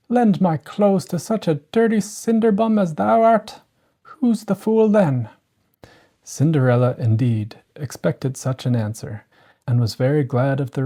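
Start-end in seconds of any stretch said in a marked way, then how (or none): 0.1-5.3 looking down on a dirty cinderbum; scornful stepsister. A narrator's reading. Miss Charlotte refuses Cinderella, high contemptuous voice.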